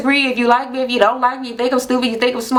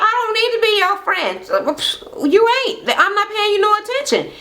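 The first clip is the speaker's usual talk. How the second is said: high-pitched mock sass; retold quarrel, pitched well up; dismissive comeback; 10 semitones higher than usual. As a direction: imitate someone brushing off a rival.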